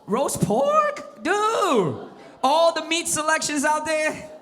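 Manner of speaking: indignant squeal; mock outrage. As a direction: react like you've been gravely insulted. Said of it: "outraged at dad's insult".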